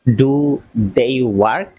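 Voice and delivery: each word drawn out slowly for learners. Shown as a demonstration. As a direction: spell it out slowly like a teacher. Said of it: "আমি খুব আস্তে আস্তে বলছি". (খুব ধীরে)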